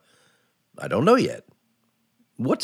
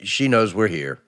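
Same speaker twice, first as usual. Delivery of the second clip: ordinary delivery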